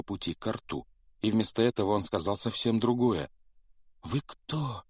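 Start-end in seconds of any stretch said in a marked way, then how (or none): 4.0-4.8 put-on timid boy. A narrator's reading. Boy asks, high.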